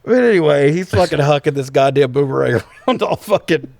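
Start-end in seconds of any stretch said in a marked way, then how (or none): none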